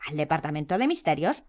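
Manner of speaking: ordinary delivery, nothing marked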